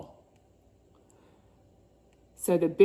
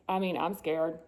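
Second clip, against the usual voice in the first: ordinary delivery, nothing marked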